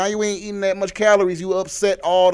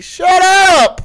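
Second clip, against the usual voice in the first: loud, high-pitched outburst; exasperated yell; 12 semitones higher, 8 dB louder than usual. Acted. Ranter ordering Monique to stop, exasperated yell. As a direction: yell it at the top of your register.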